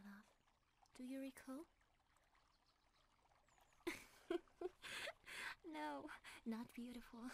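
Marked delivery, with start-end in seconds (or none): 3.8-5.6 fond laugh. Acted.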